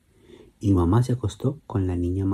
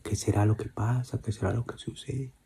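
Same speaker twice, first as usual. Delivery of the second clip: thinking aloud; 10 dB quieter than usual. Acted. Father musing alone about the noises, quiet. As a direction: ponder quietly to yourself.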